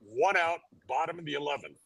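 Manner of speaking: mock sportscaster score update; game update. Real, not acted. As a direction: report the score like a radio call. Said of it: "let me give you an update".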